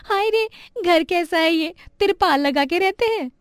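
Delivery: put-on high-pitched child's taunt; mocking schoolkid. Classmate mocking Chhavi's tarpaulin house, teasing. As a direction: jeer in a high, pitying-mocking voice. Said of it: "उसकी गरीबी के लिए चिढ़ाते थे".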